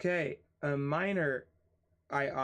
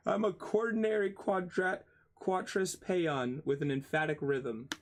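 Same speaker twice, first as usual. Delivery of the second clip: higher-pitched, hammed-up declaration; mock proclamation; 5 semitones higher than usual. Streamer jokingly declares himself a poetic term, mock-grand. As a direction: announce it like an absurd boast, easing down.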